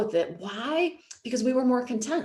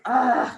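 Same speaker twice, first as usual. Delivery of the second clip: exasperated groan; 4 semitones higher, 5 dB louder than usual. Retold or imitated. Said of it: "And then I'm like".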